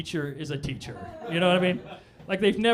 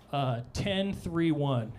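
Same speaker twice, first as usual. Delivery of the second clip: slow; 3 semitones lower, 4 dB quieter, much slower than usual. Real, not acted. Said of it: "Has anyone heard this?".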